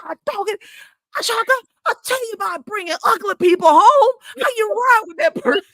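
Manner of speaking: high, loud, fast mock scold through laughter; hammed-up dad fussing at his daughter; dad's mock scolding. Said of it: "And he was like".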